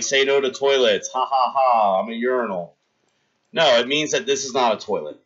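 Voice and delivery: ordinary talk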